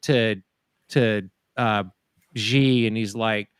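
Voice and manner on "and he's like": usual speaking voice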